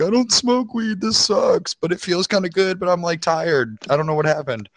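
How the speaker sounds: high, whimpering stoned complaint; whiny stoned crying. Retold or imitated. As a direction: whine like a scared high kid. Crying high under pool table.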